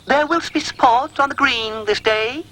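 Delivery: high, lordly dog voice; pompous Rhubarb. A narrator's reading. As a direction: proclaim it grandly. Lordly speech continued.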